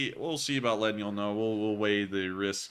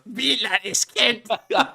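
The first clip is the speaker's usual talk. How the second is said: slow, high, loud mock German yell; German accent; 13 semitones higher, 9 dB louder, much slower than usual. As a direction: imitate the botched German accent. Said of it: "All I heard was".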